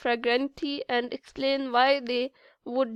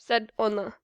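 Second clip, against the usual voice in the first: ordinary delivery, nothing marked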